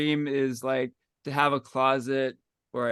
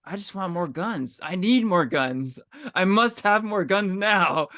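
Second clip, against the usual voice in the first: escalating demand; 6 semitones higher, 3 dB louder than usual. Retold or imitated. Podcaster playing up a craving for prop guns, mounting mock desperation. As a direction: mock-desperate demand, building louder and higher.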